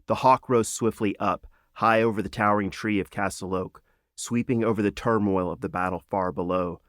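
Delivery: ordinary delivery, nothing marked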